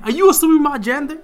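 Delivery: high, mocking offended tone. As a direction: parody someone easily offended. Mocking a triggered person.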